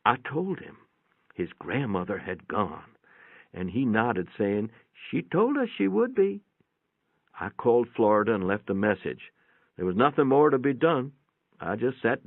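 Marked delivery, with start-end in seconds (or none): 4.9-6.4 put-on higher boy's voice, matter-of-fact; lightly voiced boy, unbothered; the boy shrugging off the news, higher and unsurprised; grandson. A narrator's reading. Grandson's calm reply voiced.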